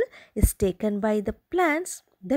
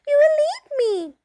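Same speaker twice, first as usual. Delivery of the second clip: high, fast panicky rabbit; squeaky fast pleading; frightened rabbit cry; 12 semitones higher, 5 dB louder, much faster than usual. Acted. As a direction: blurt it out like a scared bunny. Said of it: "cried the rabbit".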